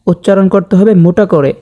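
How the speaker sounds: ordinary delivery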